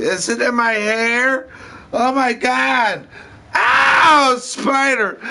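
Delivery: put-on high panicked childlike screaming; shaking off a spider. Panicked screaming about spider in hair.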